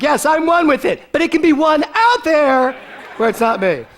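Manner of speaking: high, loud mock defensive voice; mock answer. Friend justifying her lizard panic, high loud mock voice. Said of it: "the answer was something like".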